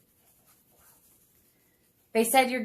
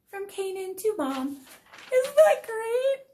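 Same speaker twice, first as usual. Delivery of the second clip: much higher, drawn-out gush; gushing delight; 13 semitones higher, slower than usual. Real, not acted. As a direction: squeal it like a proud mom. Mom gushing over son's poem.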